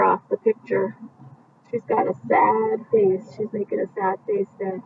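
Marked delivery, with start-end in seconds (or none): none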